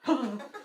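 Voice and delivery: short gasps of sleeping panic; imitated nightmare gasps. Husband tossing in a nightmare, panicked gasps. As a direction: gasp as if trapped in a dream. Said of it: "he kept doing this".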